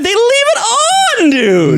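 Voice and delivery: very high, loud laughing shout; shrieking through laughter. Real, not acted. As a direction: screech it out in disbelief. Disgusted laughing outburst.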